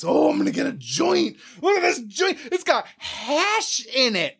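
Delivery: high-pitched, over-excited mimicry; mock excited friends. Friends getting their first joints in Amsterdam, mock excited. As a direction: imitate giddy first-time smokers. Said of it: "get so fucking excited about".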